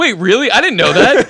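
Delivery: high, loud burst of surprise; astonished. Real, not acted. Co-host learning of the Olympic silver, loudly astonished. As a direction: blurt it out in amazement.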